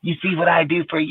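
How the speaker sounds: quick high-pitched mock nagging; angry girlfriend. Girlfriend listing what she does for him, high mock scolding. Acting out partner's complaint.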